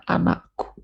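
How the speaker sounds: put-on soft, intimate call to a child; tender call. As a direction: murmur it like a loving father. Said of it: "makanya dikatakan". (lembut seperti bapa memanggil anaknya)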